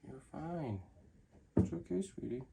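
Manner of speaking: soft, hushed reassurance; calm a nervous pet quietly; soothing murmur. Real, not acted. Soothing the snake softly.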